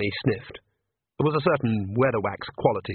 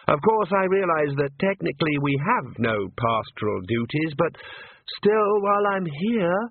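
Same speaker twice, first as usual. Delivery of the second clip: higher, hesitant priest voice; nervous young priest; 4 semitones higher than usual. Acted. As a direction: stumble politely, a bit anxious. Priest stammers his excuse.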